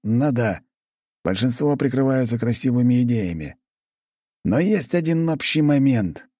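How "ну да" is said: ordinary delivery, nothing marked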